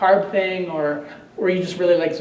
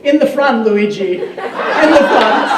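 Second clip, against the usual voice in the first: much higher and louder, laughing through the words, the last bit slow; laughing punchline; 5 semitones higher, 10 dB louder, slower than usual. Real, not acted. Teacher laughing through the joke's punchline to her class, much higher. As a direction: crack up while delivering the punchline.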